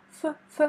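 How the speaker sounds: a breathy F, lips on teeth, held; F sound demo. Shown as a demonstration. Teacher shows mouth shape to student for F. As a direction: show final в as F. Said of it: "Prononce comme moi".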